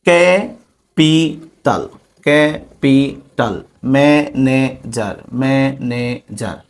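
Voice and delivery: Capital and manager slowly broken in three; ক্যা, পি, টাল with gaps, likewise manager; split into syllables. Shown as a demonstration. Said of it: "তিনবার ভেঙে উচ্চারণ করা লাগতেছে". (Capital, manager তিন ভাগে ভেঙে ধীরে উচ্চারণ)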